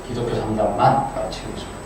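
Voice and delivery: ordinary delivery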